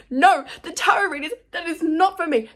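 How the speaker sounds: loud, high, emphatic no; rejecting the tarot reading, loudly; loud refusal. Real, not acted. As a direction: shout down the temptation. Firmly refusing to contact him.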